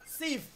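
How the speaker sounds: "sif" ending closed on an F sound, lips shut; ordinal ending demo. Shown as a demonstration. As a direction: say "sif" as the English sixth, with an F at the end. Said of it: "Entonces tú dices".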